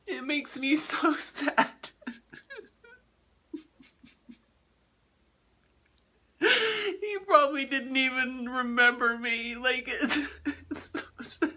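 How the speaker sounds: sobbing through the words; crying. Real, not acted. Crying over her dead dog.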